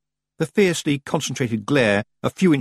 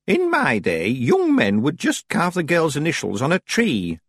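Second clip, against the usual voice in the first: put-on old witch voice; 6 semitones higher than usual. Old witch reminiscing dryly.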